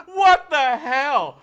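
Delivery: slow, loud, high laughing cry; laughing outrage. Retold or imitated. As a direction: stretch it out in laughing despair. Retold outburst at airport.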